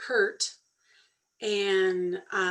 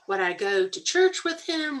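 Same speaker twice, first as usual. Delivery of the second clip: ordinary delivery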